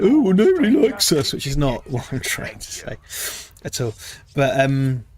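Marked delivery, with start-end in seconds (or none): 0.0-1.3 self-pitying mopey whine; hangdog whine. Retold or imitated. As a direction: play it sorry for yourself. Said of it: "God, I sound so hangdog".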